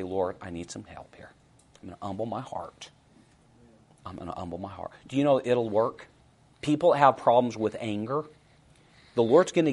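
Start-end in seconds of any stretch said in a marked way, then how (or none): 0.0-2.9 soft unvoiced prayer; whispered prayer. Believer praying quietly in a heated moment, whispered. 4.1-4.9 very soft hushed repeat; hushed prayer. Acted. Believer repeating the prayer to God, very soft.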